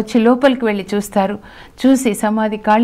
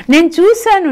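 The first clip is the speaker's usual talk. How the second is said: much higher, eager cry of testimony; joyful witness; 8 semitones higher, 4 dB louder than usual. A narrator's reading. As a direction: proclaim it with joy. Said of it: "పట్టలేని సంతోషంతో". (ఆనందంగా, ఎత్తైన స్వరంతో సాక్ష్యం)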